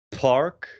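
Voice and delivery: "park" with a big puff of air on the p; strongly aspirated "park", English style. Shown as a demonstration. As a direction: explode the P of "park" dramatically. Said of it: "kind of, uh, dramatically".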